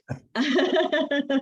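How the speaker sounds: high, light real laugh. Real, not acted. Director laughing after correcting her group's name, real laugh.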